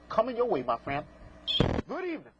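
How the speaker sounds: ordinary talk, nothing marked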